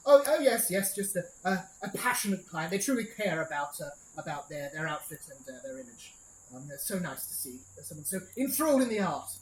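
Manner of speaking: smooth over the lord's outburst; nervous clerk. Acted.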